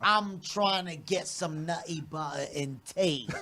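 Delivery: slow, drawn-out mock British accent. Shown as a demonstration. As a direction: politely stretch it out in British. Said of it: "do your best British accent".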